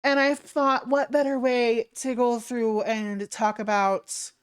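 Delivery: high, peppy streamer voice; perky hostess welcoming viewers; cute anime streamer. Acted. Greeting raiders in stream voice.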